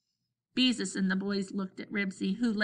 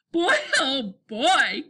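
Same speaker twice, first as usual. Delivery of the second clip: slow, high, loud gasp of amusement; 5 semitones higher, 6 dB louder, much slower than usual. A narrator's reading. Scooter worn out laughing at Henry, gasping. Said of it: "gasped Scooter".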